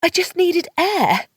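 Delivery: high, slow, lofty excuse; haughty lie. A narrator's reading. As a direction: brush him off haughtily. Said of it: "I lied haughtily".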